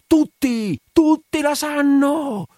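very high, loud, drawn-out scream; mad yell. A narrator's reading. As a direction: scream it like a madman. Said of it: "urlò come un pazzo". (urlo da pazzo, acuto e disperato)

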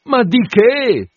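slow, high, loud outburst of disbelief; cry out. Acted. Narrator cries out at the landlord, slow and loud.

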